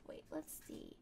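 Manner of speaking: quick whisper. Real, not acted. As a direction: mutter it under breath. Whispered aside.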